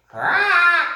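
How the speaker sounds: very high, loud screech; parrot screech. Acted. Parrot screeching to wake the jungle. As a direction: screech from the branch. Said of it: "began to screech".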